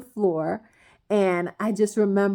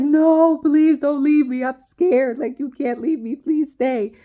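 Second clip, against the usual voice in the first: scared pleading; 6 semitones higher, 6 dB louder than usual. Retold or imitated. Begging daughter not to leave.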